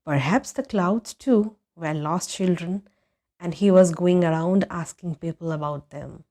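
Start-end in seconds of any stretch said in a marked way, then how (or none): none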